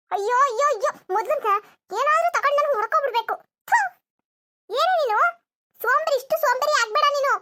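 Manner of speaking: hammed-up exasperated mother-in-law; angry scolding. Mother-in-law scolding sleepy daughter-in-law, angry and exasperated. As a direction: wail and scold in a temper. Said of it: "ಇಷ್ಟು ಕೋಪ ಮಾಡ್ಕೋಬೇಡಿ ಅತ್ತೆ".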